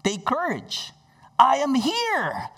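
"I am here" high and drawn out; emphatic assurance. Retold or imitated. Jesus to frightened disciples, emphatic "I am here". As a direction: lift "I am here" slowly into a ringing declaration. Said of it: "That's when he had the emphasis".

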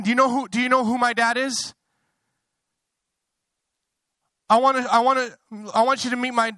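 high, loud, bursting with excitement; childlike excitement. Acted. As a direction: gush like a child showing off Dad. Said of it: "get excited and say".

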